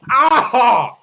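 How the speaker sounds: loud, high startled yell; sudden high-pitched "ah!"; startled scream. Acted. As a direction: cry out as if jumped by something overhead. Startled in game after looking up.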